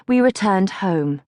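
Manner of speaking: ordinary delivery, nothing marked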